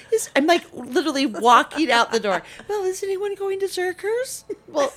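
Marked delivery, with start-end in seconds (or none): 2.7-4.4 high, put-on teen voice asking a favor; mock the daughter's sing-song request; mimicking whiny daughter. Mom mimicking her daughter asking for a Zucker's stop, high put-on voice. 4.7-4.9 sarcastic retort. Retold or imitated. Mom's retort, mock exasperation.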